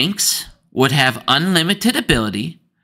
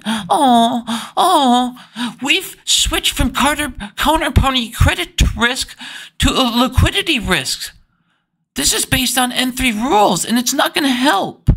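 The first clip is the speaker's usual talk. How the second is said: high, whiny nasal-free mockery, much higher pitch; mock whining; 9 semitones higher than usual. Mocking the ISDA Twitter poster, high whiny voice. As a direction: whine it out like a sulky banker. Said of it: "and he's whining".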